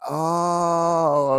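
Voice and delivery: long drawn-out high "oh"; exaggerated dawning understanding; acted realization. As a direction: play the penny finally dropping. Retelling moment the joke clicked.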